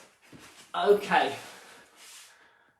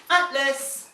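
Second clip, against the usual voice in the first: loud, high call of Atlas; calling the dog; 8 semitones higher, 6 dB louder than usual. Real, not acted. Trainer calling the puppy by name in a loud high call. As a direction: ring out the name to call him in. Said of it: "I'm gonna actually use his name".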